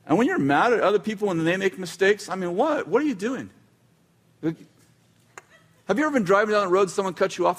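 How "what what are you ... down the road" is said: ordinary delivery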